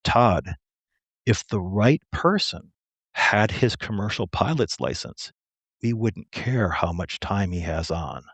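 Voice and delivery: slow, measured, low-key boss; imitating a quietly authoritative superior; knowing hint. Sergeant hints weightily at an offer.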